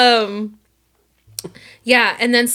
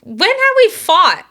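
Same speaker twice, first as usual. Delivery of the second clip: indignant; 9 semitones higher, 5 dB louder, slower than usual. Real, not acted. Indignant denial.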